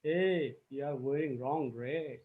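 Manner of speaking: very soft, low customer voice; customer's hushed remark. Shown as a demonstration. Customer in the field to the visiting worker, hushed remark. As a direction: drop right down in pitch and volume, almost under the breath. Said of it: "your customer says".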